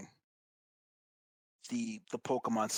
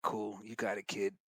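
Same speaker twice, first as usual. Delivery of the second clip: softer, lower, offhand mob boss; Giovanni; 5 semitones lower, 7 dB quieter, slower than usual. Retold or imitated. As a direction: shrug it off like a bored crime boss. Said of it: "Giovanni's just kinda like".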